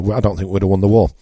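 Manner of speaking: usual speaking voice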